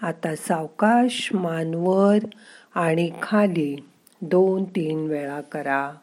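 slow, soft relaxation voice; guided meditation. Acted. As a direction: calmly guide the breathing, slowly. Leads breathing and relaxation exercise.

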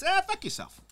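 hammed-up contempt; mock dismissive jab. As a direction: flick it off like a bored conqueror. Crusaders voiced dismissing locals.

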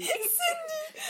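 high laughing shout of a name. Real, not acted. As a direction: shriek the name mid-laugh.

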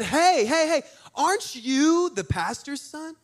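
high-pitched, put-on lady's voice; high, drawn-out nosy question; excited church lady. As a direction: flag down a kid with eager recognition. Acts out lady approaching him.

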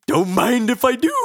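high, loud cartoon gone-crazy outburst; Homer. Cartoon dad going crazy, manic mock glee. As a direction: shriek it with deranged glee. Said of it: "make Homer something something go crazy".

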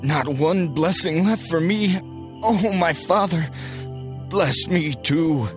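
high, anguished begging; desperate pleading. A narrator's reading. Son begging for one blessing, high desperate plea. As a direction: plead at high pitch, stretching the last words. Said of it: "Esau pleaded".

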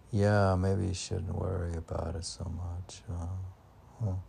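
ordinary talk

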